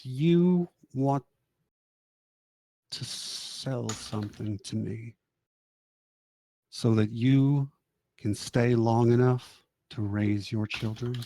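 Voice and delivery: slow, halting tempter with long pauses; mysterious deal-maker. Acted. Mysterious stranger offering a dying parent a deal, slow and deliberate. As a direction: let the offer hang between slow phrases. Voicing mysterious dealer at deathbed.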